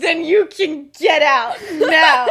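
loud, drawn-out mock dismissal; hammed-up performance. James ordering his friend out in a staged fight, hammed-up outrage. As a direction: throw them out theatrically, high and slow, half laughing. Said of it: "James gives the performance of a lifetime".

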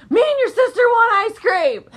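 high and loud, cracking up; loud, high, giggling announcement; laughing demand. Retold or imitated. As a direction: blurt it out through laughter. Demanding ice cream, retold.

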